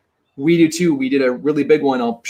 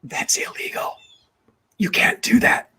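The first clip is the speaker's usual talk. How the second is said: imitating the title closer's hushed warning; retold whisper; 6 semitones higher, 3 dB quieter than usual. Title closer warning Nate about wholesaling, whispered. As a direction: whisper it like a secret. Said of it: "whispered really close to me".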